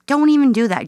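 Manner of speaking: a sharp don't-you-dare; emphatic warning. Real, not acted. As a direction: put your foot down on it. Stern warning to viewers.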